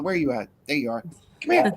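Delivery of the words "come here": high-pitched pet call; coaxing a dog. Real, not acted.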